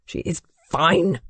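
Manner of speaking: slow, with "fine" set apart sharply; sharp stress on fine. A narrator's reading. Duke shutting down the maid's question, sharp "fine". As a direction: make "fine" a pointy word. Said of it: "as if fine was a sharp, pointy word".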